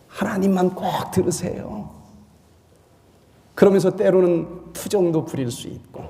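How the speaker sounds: ordinary talk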